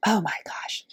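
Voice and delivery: breathless whispered oh my gosh; real astonished whisper; awed whisper. Real, not acted. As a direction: whisper it, overwhelmed by the gift. Overwhelmed by donated fabric.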